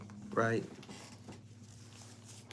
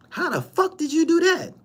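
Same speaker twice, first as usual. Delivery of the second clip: mock amazement; 14 semitones higher than usual. Mocking outburst at a rival.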